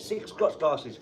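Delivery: normal talking voice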